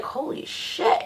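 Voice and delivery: breathless whispered awe; awed whisper. Retold or imitated. Telling friends of the dream portal, hushed awed whisper.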